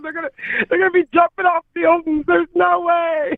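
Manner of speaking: high, fast, hammed-up alarm; over-the-top dismay, then slowing down; mock panic. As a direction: play the men freaking out, pitch up. Mock panic about men.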